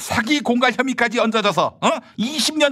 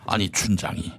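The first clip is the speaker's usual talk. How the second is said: low stern minister; 13 semitones lower than usual. Acted. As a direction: press him like a cold interrogator. Low voiced rival character.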